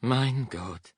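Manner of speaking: exasperated mutter to the heavens; muttering exasperation. A narrator's reading. German guard keeps his temper with prisoner, muttering in exasperation.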